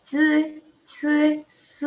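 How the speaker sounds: "Z, z, z" as zi, ci, si with air; sustained sibilants, one at a time; airy sibilant drill. Shown as a demonstration. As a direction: let air hiss through each syllable. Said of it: "Jangan lupa ada angin ya".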